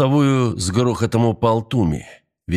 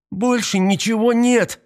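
very high, drawn-out cry; desperate shout; 13 semitones higher, slower than usual. A narrator's reading. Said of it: "крикнула Мария".

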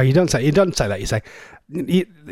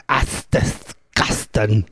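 slow, low Norfolk "That's disgusting"; Norfolk accent; 7 semitones lower, 4 dB quieter, much slower than usual. Shown as a demonstration. Host showing Flash the phrase to shout in the park, slow Norfolk. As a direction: drawl it out in broad Norfolk. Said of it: "shout at the top of your voice".

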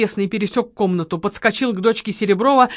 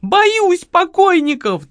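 high, loud, drawn-out wail; wailing fear; 10 semitones higher, 5 dB louder, much slower than usual. A narrator's reading. Lola fears the dead, wailing loudly. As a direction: howl it slowly in terror. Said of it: "взвыла Лола".

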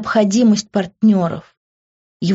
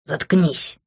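angry hiss; 3 semitones lower than usual. A narrator's reading. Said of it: "Зло прошипел он".

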